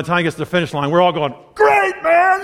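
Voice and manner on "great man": high shouted sarcastic cheer; mock cheer. Kids at the finish line teasing the slow runner, mock cheer. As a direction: yell it like a teasing crowd.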